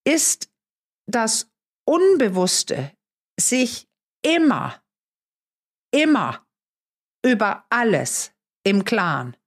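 slow, word by word, with long pauses; deliberate, weighty pauses between phrases; emphatic. Real, not acted. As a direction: spell it out slowly for emphasis. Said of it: "Ich kann es nur betonen".

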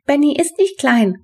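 high, loud objection; indignant call. A narrator's reading. Henrietta defends Benny to his mother, calling out. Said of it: "rief Henrietta".

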